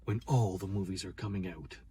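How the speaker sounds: low, hushed trailer narrator; movie-trailer voice. Shown as a demonstration. Showing co-host how to say the trailer line, low hushed announcer voice. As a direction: intone it low and soft like a trailer. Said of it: "You gotta say it like that".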